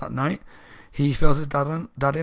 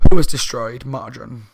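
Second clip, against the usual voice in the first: booming knight; 10 dB louder than usual. Retold or imitated. Knight demands answer.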